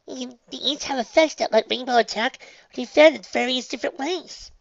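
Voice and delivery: high, eager little filly; chirpy young pony explaining; high girl voice. Acted. Sweetie Belle voiced, high.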